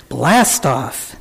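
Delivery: ordinary delivery, nothing marked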